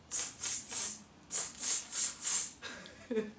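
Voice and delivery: soft unvoiced tsk sounds; monkey tsk. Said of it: "all the monkeys go".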